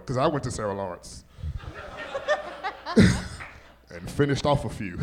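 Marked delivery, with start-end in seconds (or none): none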